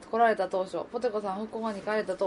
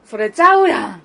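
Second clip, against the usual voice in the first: 「それちゃうやん」 loud, weight on the last part; Kansai stress at end; 14 dB louder than usual. Shown as a demonstration. Host demoing Osaka phrasing to co-host: loud, end stressed. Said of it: "後半を張ったらいいね".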